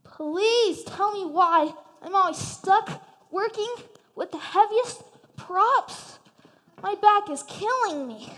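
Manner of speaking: slow, strained whining; whiny complaint. Acted. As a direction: groan about your aching back. Second pig complaining of sore back.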